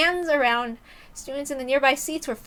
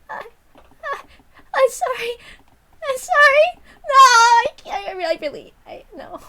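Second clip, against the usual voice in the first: high, breaking, sobbing sorry; crying apology; 10 semitones higher, 9 dB louder than usual. Acted. Sadako begging forgiveness from the class, high and sobbing. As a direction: fall apart mid-apology.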